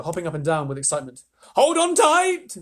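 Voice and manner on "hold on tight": very high, loud, slow warning; warning shout. Acted. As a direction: call out to brace everyone. Grasshopper warns as peach lurches.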